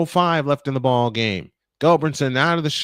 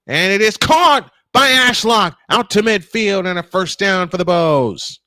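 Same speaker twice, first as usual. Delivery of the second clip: raised, punched-up play-by-play voice; excited catch call; 4 semitones higher, 5 dB louder than usual. Real, not acted. Announcer calls a first down for the Bows, excited. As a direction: burst out as the pass lands, then stretch the finish. Live call of a completed pass.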